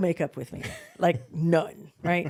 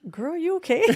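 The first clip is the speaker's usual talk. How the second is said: concerned friend; 10 semitones higher, 3 dB louder than usual. Retold or imitated. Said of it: "she goes".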